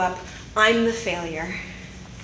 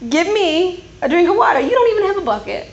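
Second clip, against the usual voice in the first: higher, louder mock disbelief; mocking scoff; 6 semitones higher, 3 dB louder than usual. Samaritan woman mocking Jesus, who has no bucket, with scoffing disbelief. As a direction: scoff at the idea, teasing him. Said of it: "she says to him".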